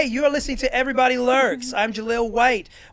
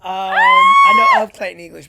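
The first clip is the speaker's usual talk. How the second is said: much higher, louder, slow; emphatic; 12 dB louder, slower than usual. Real, not acted. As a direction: stretch it out, amused and loud. Emphatic reaction to name.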